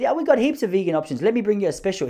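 lightly voiced waiter, pitched high; imitated waiter. Waiter offering a vegan special, lightly imitated in high voice. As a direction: lightly play an eager, reassuring waiter. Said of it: "he said".

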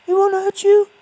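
very high, loud gentle coaxing; high-pitched voice. Acted. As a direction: coo at it like a scared pet. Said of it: "why are you speaking in a high-pitched voice".

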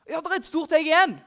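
light up at the big egg; excited discovery. Real, not acted. Preacher finding the egg for the children, bright and excited.

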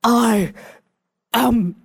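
slow, halting mock Klingon; lightly voiced angry Klingon, word by word; bad Klingon impression. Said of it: "I'll do my impression of her doing a Klingon".

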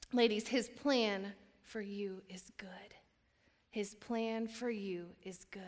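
slow, hushed reassurance; slow down and soothe them; soft slow sermon assurance; tender reassurance. Real, not acted.

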